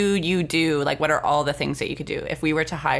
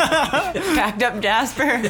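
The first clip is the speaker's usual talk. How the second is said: laughing; 7 semitones higher, much slower than usual. Real, not acted. Joking about Jasper moving.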